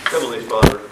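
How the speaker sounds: normal talking voice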